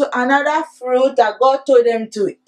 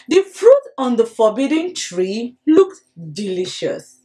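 usual speaking voice

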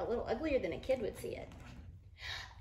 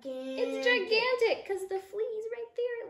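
higher and slower, stretched with amazement; wide-eyed wonder; 10 semitones higher, slower than usual. Acted. Teacher explaining the flea's view, amazed. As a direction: show the children how huge it is. Flea sees the cat.

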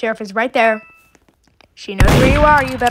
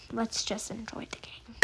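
whisper; 2 semitones lower, 18 dB quieter than usual. Real, not acted. Quiet aside to viewers.

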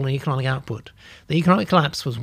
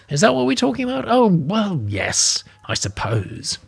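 put-on dreamy old lady, pitched higher; 5 semitones higher than usual. Old lady answering her colleague vaguely, dreamy and higher. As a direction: drift off like a tipsy old dear. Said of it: "The old lady paused".

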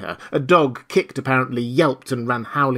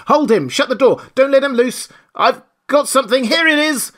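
much higher, louder yells; frantic shouts; 13 semitones higher, 7 dB louder than usual. Acted. Villagers grappling the invisible man, frantic loud yells. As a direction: shout over each other in chaos. Crowd yelling in brawl.

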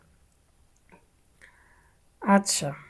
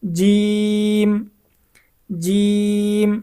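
drawn-out letter; much slower than usual. Shown as a demonstration. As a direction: stretch জিম very slowly, mouth wide open. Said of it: "জবান খুলে উচ্চারণ করতে হবে।". (খুব টেনে ধীরে জিম)

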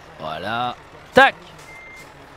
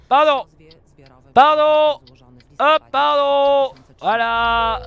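calling pardon; 4 semitones higher, 6 dB louder, much slower than usual. Pretend. Pushing through traffic again.

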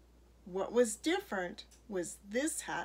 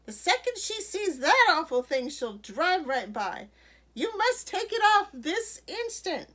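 loud, high, bossy outburst; scandalised storybook busybody; crying out; 8 semitones higher, 7 dB louder than usual. A narrator's reading. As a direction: scold and command at full voice. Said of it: "Missus McSnoot cried".

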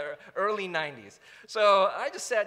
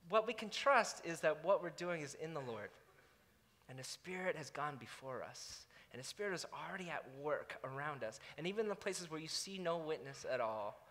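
earnest exhortation; 6 semitones lower, 11 dB quieter than usual. Acted. Quiet earnest sermon exhortation.

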